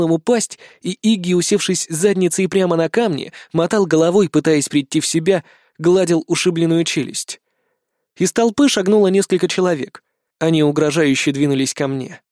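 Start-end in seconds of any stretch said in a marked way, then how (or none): none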